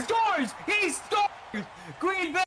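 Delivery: high shouted goal call; excited goal call. Real, not acted. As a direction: yell it like the crowd erupts.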